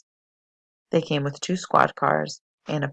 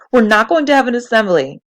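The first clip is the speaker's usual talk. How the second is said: loud, high and out of breath; panting protest; breathless shout; 8 semitones higher, 11 dB louder than usual. A narrator's reading. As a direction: blurt it out gasping for air. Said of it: "gasping for breath".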